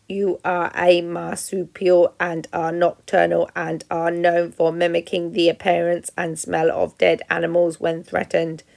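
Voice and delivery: measured mechanical voice reciting facts; robot voice. Acted. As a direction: read it like a careful machine.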